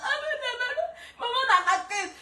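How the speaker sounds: high, laughing fake sobs; mock crying. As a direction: whimper in jest while laughing. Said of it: "on peut pleurer comme ça". (pleurs pour rire, aigus et entrecoupés de rires)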